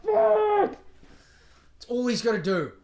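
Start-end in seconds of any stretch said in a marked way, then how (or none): 0.0-0.8 high, loud yell into a pillow; screaming into pillow. Pretend. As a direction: scream out all the rage. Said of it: "Screaming in the fucking pillow".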